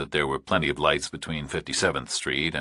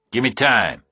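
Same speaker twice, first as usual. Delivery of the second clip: louder, higher, tense; tense Burke; 6 semitones higher, 5 dB louder than usual. A narrator's reading. Burke answering the kidnapper, tense and louder. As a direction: push through nerves.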